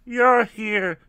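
high, loud exclamation; loud, dramatic high cry. A narrator's reading. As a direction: cry it out, pitch way up. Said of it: "Tracy cried".

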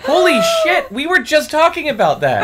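squealing with delight, high and loud; thrilled, voice shooting up; excited. Acted. Thrilled by liquor gift.

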